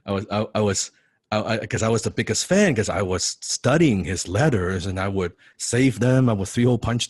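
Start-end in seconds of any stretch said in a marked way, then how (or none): none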